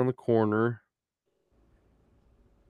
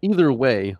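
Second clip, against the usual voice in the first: normal talking voice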